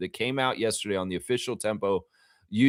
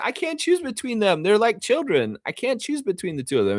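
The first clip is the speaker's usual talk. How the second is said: lightly imitating a sweet high voice, much higher; cute high voice; 10 semitones higher than usual. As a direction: coo it like a proud parent. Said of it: "in the most cutest way".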